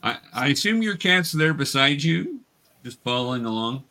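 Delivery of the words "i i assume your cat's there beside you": normal talking voice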